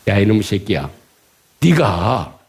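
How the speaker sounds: low-pitched opening of an angry rant; rough address, held low before the outburst; acted resentment. Israelites starting to curse at Moses, acted low resentment. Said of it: "원망이에요".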